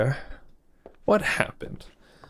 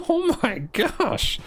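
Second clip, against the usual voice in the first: laughing; 8 semitones higher, much slower than usual. Real, not acted. Laughing at cutscene shooting.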